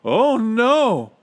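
high, loud cry of dismay; stretched-out high-pitched alarm; horrified. A narrator's reading. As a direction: cry out aghast. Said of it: "gasped Bartholomew".

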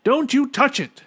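very high, loud warning; high-pitched frantic order; shouting. A narrator's reading. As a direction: shout it urgently. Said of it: "shouted Bartholomew".

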